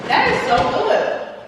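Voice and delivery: high, slow, thrilled praise; excited. Real, not acted. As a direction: gush over the flavour. Tasting the grape candy.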